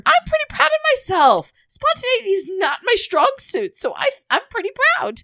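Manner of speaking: high, loud giggling words; laughing. Real, not acted. Cohost giggles about her own spontaneity. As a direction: talk through the giggles.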